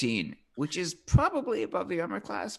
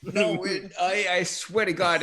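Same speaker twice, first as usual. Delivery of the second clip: normal talking voice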